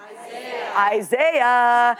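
"Isaiah" in an American accent, eye-ZAY-uh. Shown as a demonstration. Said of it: "they say, "Isaiah".

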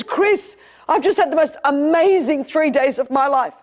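imitating a gushing friend, pitched high; retold excitement. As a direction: gush like a thrilled friend with news. Retells friend gushing about rave.

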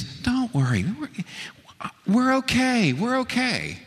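high, sing-song calming voice; calm the boatman with a lifted, patronizing tone; soothing reassurance. Retold or imitated. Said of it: "And he says".

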